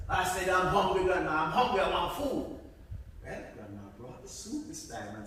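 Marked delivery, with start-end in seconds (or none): 0.1-2.6 louder, whiny demand for food. Acted. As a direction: insist you're hungry.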